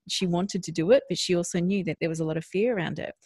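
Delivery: usual speaking voice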